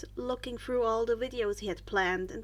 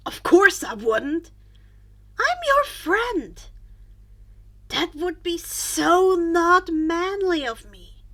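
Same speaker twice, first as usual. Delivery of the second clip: loud, high and slow, earnestly promising; eager friend; 4 semitones higher, 10 dB louder, slower than usual. A narrator's reading. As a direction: swear loyalty like a proud eager kid.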